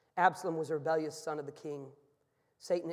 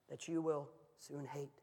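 usual speaking voice

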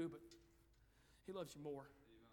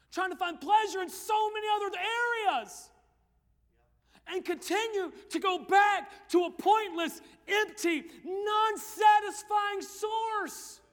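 a preacher's rising cry; preaching at full force; 11 semitones higher, 17 dB louder, much slower than usual. Acted. Preacher shouting at the youth crowd about empty pleasure. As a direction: shout it from the pulpit, dragging the last words.

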